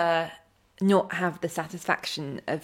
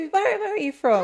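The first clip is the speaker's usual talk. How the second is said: posh inquiry; 11 semitones higher than usual. Pretend. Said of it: "imagining someone coming in".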